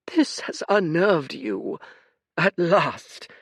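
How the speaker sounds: put-on high, tired old man voice; a sorrowful old oracle; weary old seer. Fate's voice.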